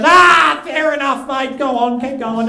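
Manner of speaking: high yelled concession, loud at the start; aggressively friendly bikie. Retold or imitated. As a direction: back off amiably but keep yelling. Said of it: "they still have to be friendly".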